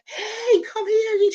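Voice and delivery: very high screamed call; screaming. Retold or imitated. Screaming to husband from the porch, high call. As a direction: scream for husband across yard. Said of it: "I was screaming so hard".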